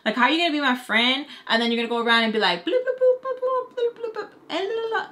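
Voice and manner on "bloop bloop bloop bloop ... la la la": high mocking singsong babble. Mimicking a two-faced friend gossiping behind her back, high mock babble. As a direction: squeak it out like silly gossip.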